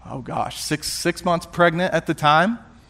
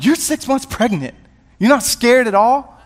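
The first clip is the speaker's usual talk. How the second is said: high-pitched, disbelieving questions; can't believe she isn't scared; incredulous; 7 semitones higher than usual. Retold or imitated. Questioning his pregnant wife's lack of fear.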